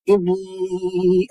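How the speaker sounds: إني dragged out far too long; very slow, over-long إني; overstretched vowel. Shown as a demonstration. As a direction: hold the final ee of إني excessively. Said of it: "ऐसे नहीं करना है".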